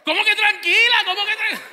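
loud, high, outraged repeat; indignant outburst. Retold or imitated. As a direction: throw the word back, furious. Esposa se indigna. (esposa indignada gritando)

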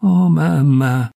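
slow, drawn-out falling "oh, mamma"; crushed stammer. A narrator's reading. Crushed daughter watching her mother, stammering lament. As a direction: stretch it out, disheartened. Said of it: "balbettò".

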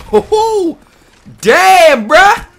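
high loud excited shout; excited yell. Real, not acted. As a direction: yell out the hype. Reacting to hit.

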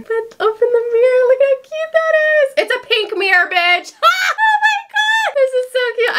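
very high, delighted squealing; real excitement. Real, not acted. Showing off a cute pink mirror, excited squeal. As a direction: squeal over it, voice shooting up. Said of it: "I'm so excited".

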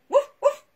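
high loud woof woof; yappy pup woofs; playful dog barks. Acted. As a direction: bark like a cheerful puppy. Dog barking in story.

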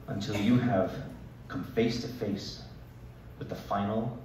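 a dad stalking the last poop, softly; hushed suspense. Pretend. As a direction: creep in like a hunter. Dramatic diaper-wipe scene.

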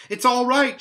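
high, loud insisting; Lucy insisting to the others she's fine; eager repeat. A narrator's reading. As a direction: tell them again, eager. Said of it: "she repeated".

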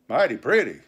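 loud, sardonic pirate retort; sceptical mutineer George. Acted. George answering the delighted crew, sceptical. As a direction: throw cold water on the cheering.

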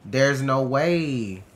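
very slow, loud and stretched; drawn-out disbelief. Real, not acted. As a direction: drag each word in despair. Overwhelmed in a fight.

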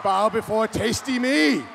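slow, loud, commanding villain decree; dark lord. Acted. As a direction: boom it out like an evil overlord. Villain guac commands the crowd.

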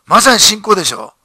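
ordinary talk

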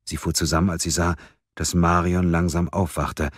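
ordinary delivery, nothing marked

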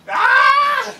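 high, loud "Ah!" scream; mock anguished scream. Reactor hamming up the sting of insults, high scream. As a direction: scream as if the insult wounded you.

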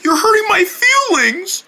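high-pitched wounded complaint; hurt whine. Acted. Joe telling the mean guy he's hurt, whining.

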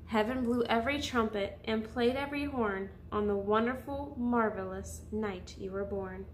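gentle storybook voice for little listeners; soothing picture-book narration; reading to children. Acted.

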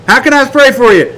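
high-pitched prayer offer; eager offer. Acted.

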